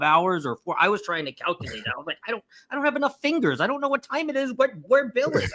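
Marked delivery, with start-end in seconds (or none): 2.3-5.5 high, rapid flustered babble; mock bewilderment. Host retelling her time-zone muddle, mock bewildered. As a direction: act out being hopelessly confused, pitched way up.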